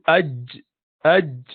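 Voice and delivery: aj with a clear j at the end; demonstrating jim; jim demo. Shown as a demonstration. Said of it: "the letter jim".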